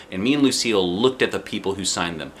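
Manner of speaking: plain reading voice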